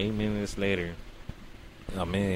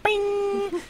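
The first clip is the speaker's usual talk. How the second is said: very high ringing sound effect; ping it out high, like something springing up; imitated "bing". Imitates springing wide awake.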